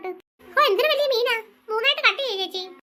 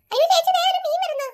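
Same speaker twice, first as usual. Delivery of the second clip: excited call; 8 semitones higher, 5 dB louder than usual. Acted. Child spots a big fish.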